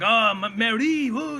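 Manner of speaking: lightly voiced lovestruck suitor; amorous cartoon skunk. Mimics cartoon skunk wooing women.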